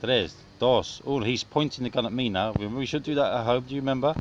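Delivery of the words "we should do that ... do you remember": ordinary talk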